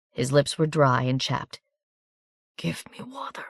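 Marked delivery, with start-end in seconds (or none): 2.6-3.5 faint breathy murmur, much softer; a wounded man barely able to ask; dry-lipped soldier begging weakly for water; murmured plea. A narrator's reading. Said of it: "he murmured".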